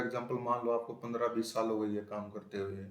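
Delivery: ordinary talk, nothing marked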